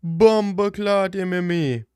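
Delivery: ordinary talk, nothing marked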